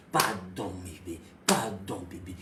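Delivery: very low, soft plea; husband softly begging his wife's forgiveness; begging pardon. Acted. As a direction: murmur a tender apology.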